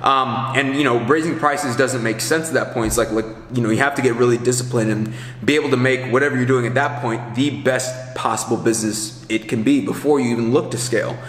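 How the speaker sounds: normal talking voice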